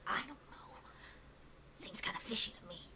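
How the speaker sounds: conspiratorial whisper; goblin whisper. Acted. Goblin gossip turns secretive.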